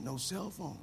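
quiet flat repeat. Pretend. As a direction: say it softly, deflated. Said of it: "Say, say that again".

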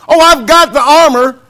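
mock excuse voice. Mocking excuses of unprepared believers.

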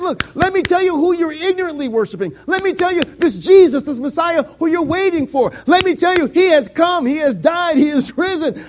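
high, loud preacher's proclamation; rousing, elated declaration. Acted. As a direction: proclaim the good news at full voice, pitched high. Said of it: "Paul was elated to go in there".

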